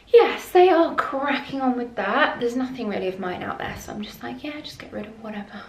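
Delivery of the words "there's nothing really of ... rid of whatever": hushed whisper aside. Real, not acted. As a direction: drop to a quick whisper. Whispering aside while others outside.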